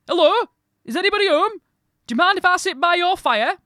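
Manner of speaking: high, loud Yorkshire holler; calling out. A narrator's reading. Traveller asking to share a fire, calling out. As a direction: shout the polite request across the yard. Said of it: "He called out again".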